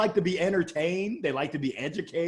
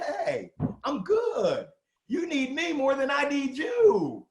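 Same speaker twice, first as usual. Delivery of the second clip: cool confident; 6 semitones higher, slower than usual. Shown as a demonstration. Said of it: "I'm not all up in your space".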